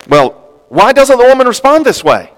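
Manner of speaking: high, loud exasperated question. Real, not acted. Preacher asking the congregation, high and exasperated. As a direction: demand an answer from the room.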